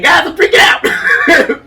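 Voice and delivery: high, loud, slow panicked shout; telling friends she is panicking, in a mock shout; hammed-up freak-out. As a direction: yell it as if losing it. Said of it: "me saying".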